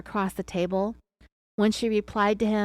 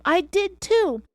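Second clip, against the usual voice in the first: higher, louder retort; a suspect shouting back at the agent; narrated scream back; 10 semitones higher, 5 dB louder, slower than usual. A narrator's reading. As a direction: fire it back at him. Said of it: "she screamed back at him".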